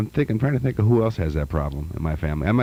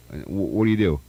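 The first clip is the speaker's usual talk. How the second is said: ordinary talk, nothing marked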